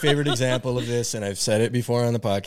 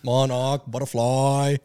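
put-on slow, higher Boston accent; 4 semitones higher, 3 dB louder, slower than usual. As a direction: draw out a broad Boston accent. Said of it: "with a Boston accent".

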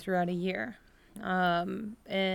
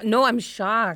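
louder, insistent shock; emphatic, sincere surprise; actually shocked; 2 semitones higher, 6 dB louder, slower than usual. Real, not acted. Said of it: "Oh, actually shocked".

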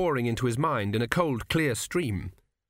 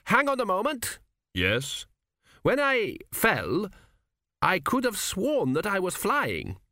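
raised, pompous king's voice; 8 semitones higher, slower than usual. A narrator's reading. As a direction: play the dead king, puzzled. The king voiced in narration.

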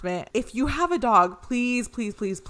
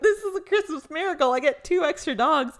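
much higher, squealing with mock delight; gleeful joke; 7 semitones higher than usual. Telling her cousin on FaceTime about the stray dogs, hammed-up glee. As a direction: sell it like a gift under the tree.

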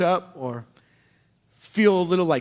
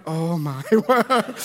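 laughing disbelief; 2 semitones lower, slower than usual. Retold or imitated. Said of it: "every parent just being like".

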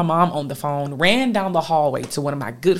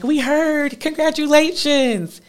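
cheerful congratulations; 12 semitones higher, slower than usual. Retold or imitated. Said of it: "They were like".

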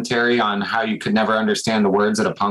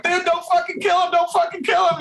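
high-pitched retold shout of panic; imitated desperate yelling; mock frantic pleading. Band pleads for singer's life.

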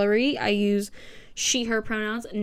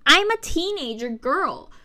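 ordinary talk, nothing marked

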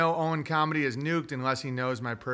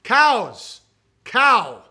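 loud cow call; 5 semitones higher, 10 dB louder than usual. Acted.

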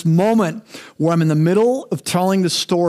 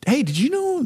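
high-pitched mock gossip opener; 9 semitones higher than usual. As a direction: imitate a busybody passing on news, pitched up. Mimics an eager gossip starting up.

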